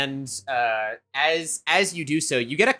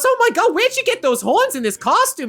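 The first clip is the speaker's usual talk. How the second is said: much higher, louder cooing mom; gushing mom; 5 dB louder than usual. Acted. Nearby mom gushing over the boy, high and loud. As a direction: gush over a cute child. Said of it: "And she goes".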